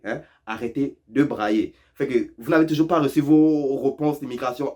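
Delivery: usual speaking voice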